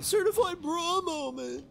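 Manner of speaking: very high, silly creature squeak; mock Ugnaught voice. Voicing the Ugnaughts' reaction, silly high squeak. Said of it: "They're like".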